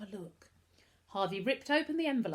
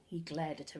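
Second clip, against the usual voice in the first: ordinary delivery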